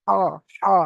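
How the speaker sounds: হা pushed out from the middle of the throat, breathy and clear; throat letter demo. Shown as a demonstration. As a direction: say হা from mid-throat, isolated and deliberate. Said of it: "গলার মাঝখান থেকে উচ্চারণ হয়". (গলার মাঝখান থেকে হা উচ্চারণ)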